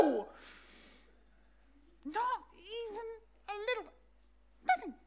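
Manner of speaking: tired fishermen snapping back; frustrated no. Shown as a demonstration. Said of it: "how would you have said it".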